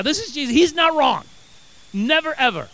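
high-pitched, forceful preaching; emphatic insistence. Real, not acted. Preacher defending Jesus to the congregation, emphatic.

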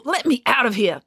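slightly muffled protest. A narrator's reading. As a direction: call out from inside something. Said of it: "Her voice was muffled now".

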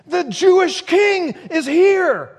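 very high, loud exultant shout; crying out. Acted. Blind beggar at the roadside hailing the Messiah, shouting out. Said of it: "sitting on the side of the road saying".

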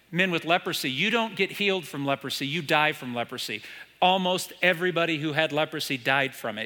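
normal talking voice

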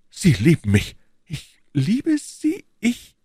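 slow, incredulous question leaping high; startled denial. Acted. Queen told that she loves him, incredulous.

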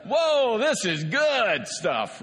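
hammed-up amazement, stretched out; mock delighted whoa. Pastor mimicking relieved delight to the congregation, mock excited.

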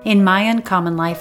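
usual speaking voice

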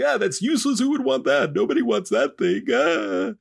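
very high mock whine; hammed-up wailing scorn; wailing father's dismissal. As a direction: moan it like a comically distraught father.

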